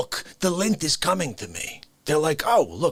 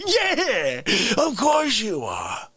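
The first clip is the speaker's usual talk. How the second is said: excited yell; 5 semitones higher, much slower than usual. Retold or imitated. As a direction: hype it up like a fan. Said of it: "you're not gonna be like".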